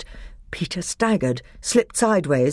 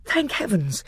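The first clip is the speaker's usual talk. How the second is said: ordinary delivery, nothing marked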